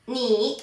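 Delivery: "ni" with the dipping third tone of Mandarin. Shown as a demonstration. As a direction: demonstrate "ni" in third tone for learners. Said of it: "tenemos el tercer tono". ("ni" con el tercer tono del mandarín)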